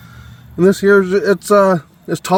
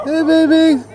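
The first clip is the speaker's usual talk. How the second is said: talking to a dog; 14 semitones higher, slower than usual. Real, not acted. Greeting the dog sweetly.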